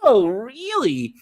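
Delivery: slow, high, drawn-out mock intrigue; feigned surprise. Client reacting to coach's rodeo tradition, exaggerated feigned surprise. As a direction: stretch it out as if fascinated. Acting out her leading reaction.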